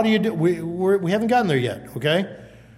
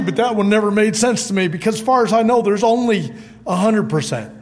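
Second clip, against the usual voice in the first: normal talking voice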